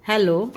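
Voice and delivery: हेलो spoken short and crisp with a rising end; hello said clearly. Shown as a demonstration. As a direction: model the word हेलो for learners. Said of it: "हेलो, ठीक है". (हेलो साफ़ उच्चारण में)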